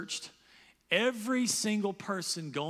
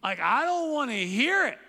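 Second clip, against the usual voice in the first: high, loud irritated snap; annoyed brush-off; 7 semitones higher, 7 dB louder than usual. Retold or imitated. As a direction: brush off a preachy friend, loudly. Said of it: "you're like".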